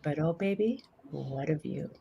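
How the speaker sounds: soft, low and lingering; tender mentor. Retold or imitated. Mentor consoles the limb dweller, tender and hushed. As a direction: land the payoff softly, like a fond confidence.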